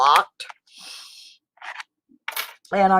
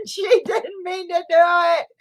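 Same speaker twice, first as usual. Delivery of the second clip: laughing, higher and squeaky; 8 semitones higher than usual. Real, not acted. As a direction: laugh through the words. Laughing, exclaiming about Jess.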